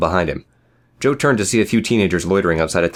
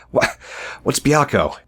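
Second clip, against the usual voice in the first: winded, halting question, pitched up; gasping; 7 semitones higher, slower than usual. A narrator's reading. Beaten Joe plays dumb to his attackers, gasping. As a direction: stammer it between coughs. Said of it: "he gasped".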